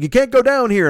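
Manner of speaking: high, loud warning shout; sharp call-out. Retold or imitated. Staff member calling out to block his path, loud high warning. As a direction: stop him cold.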